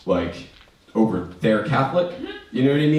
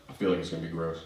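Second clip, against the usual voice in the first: wary mutter; 5 semitones lower, 11 dB quieter, much faster than usual. Retold or imitated. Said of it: "And I was like".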